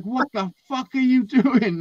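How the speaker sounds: high, drawn-out mock outrage; retold outraged dad. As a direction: play a dad bursting in, appalled. Dad bursts in on shaving son.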